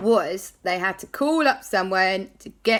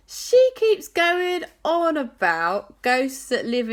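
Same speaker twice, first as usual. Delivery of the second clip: higher, slow eerie voice; spooky storytelling; 9 semitones higher, 4 dB louder, slower than usual. Acted. Jack telling friends about sea ghosts, slow and eerie. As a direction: make the ghosts sound creepy, drawn out.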